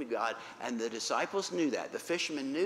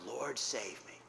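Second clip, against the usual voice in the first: ordinary delivery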